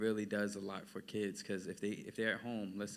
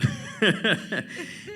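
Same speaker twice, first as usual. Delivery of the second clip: high laugh; 13 semitones higher, 13 dB louder than usual. Real, not acted.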